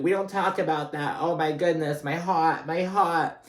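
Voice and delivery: high, mock-scandalized outcry; livid Dolores. Mom freaking out over sex talk, livid mock outburst. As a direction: imitate an upset mom, pitched well up. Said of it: "She's livid".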